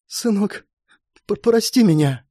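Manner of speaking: high, stammering tearful mother; quietly crying. A narrator's reading. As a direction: ask forgiveness through tears. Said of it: "Женщина тихо заплакала". (сквозь слёзы, запинаясь)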